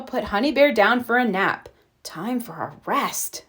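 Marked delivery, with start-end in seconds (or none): 2.0-3.4 tired inner thought. A narrator's reading.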